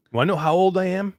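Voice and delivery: ordinary delivery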